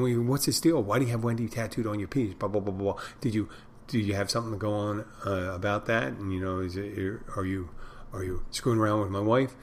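normal talking voice

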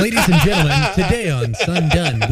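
loud, laughing host intro; announcer voice. Acted. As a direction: announce it like a showman.

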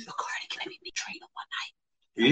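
hushed whisper. Real, not acted. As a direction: whisper it like a secret. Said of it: "whispered aside".

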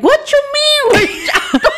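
high, laughing panicked shout; panicked disbelief. Retold or imitated. Mother to daughter, suddenly panicked, high and laughing. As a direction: mom realizing her son is missing.

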